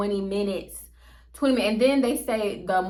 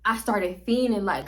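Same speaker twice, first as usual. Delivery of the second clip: ordinary delivery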